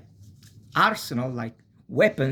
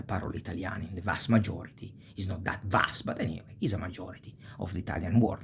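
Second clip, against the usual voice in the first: ordinary talk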